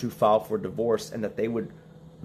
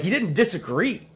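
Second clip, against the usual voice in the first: ordinary talk, nothing marked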